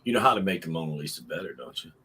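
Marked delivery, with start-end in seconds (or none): none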